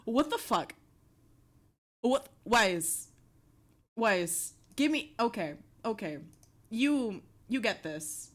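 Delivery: ordinary delivery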